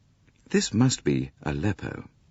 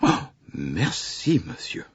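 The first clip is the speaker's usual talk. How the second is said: grateful conductor; slower than usual. A narrator's reading. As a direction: savour the tip with a drawn-out merci. Conductor thanks for the tip.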